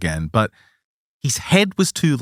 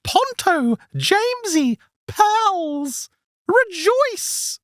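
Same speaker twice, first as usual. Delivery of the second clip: very high, sing-song eunuch voice; much slower than usual. Acted. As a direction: squeeze it up into a strange, high, sing-song creature voice. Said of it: "It says".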